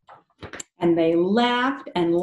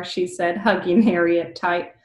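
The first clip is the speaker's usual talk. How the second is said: usual speaking voice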